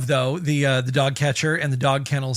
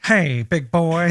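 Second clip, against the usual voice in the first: drawn-out come-hither line; Mae West impression; 3 dB louder, slower than usual. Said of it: "sort of like".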